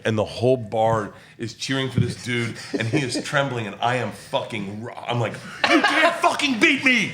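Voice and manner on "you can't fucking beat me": high, laughing yell of triumph; taunting yell. Retold or imitated. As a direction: crow it at the beaten rival. Bar drinking contest taunt.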